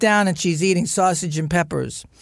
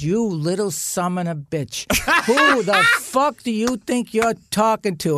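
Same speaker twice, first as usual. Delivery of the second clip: mock outrage, rising in pitch; retold furious mother; 5 semitones higher than usual. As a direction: act out a mother turning on her son. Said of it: "my mother turns on me now".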